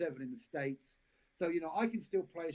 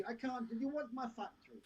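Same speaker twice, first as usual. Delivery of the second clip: put-on crap Jamaican accent; 8 semitones higher, 5 dB quieter than usual. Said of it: "She's my crap Jamaican accent".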